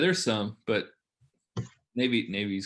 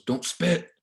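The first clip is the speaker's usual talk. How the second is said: mock officer barking, higher and louder; officer's order; 7 semitones higher, 5 dB louder, much slower than usual. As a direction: imitate the khaki yelling at him to stop. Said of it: "He goes".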